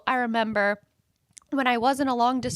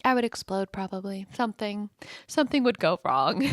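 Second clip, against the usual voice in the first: ordinary talk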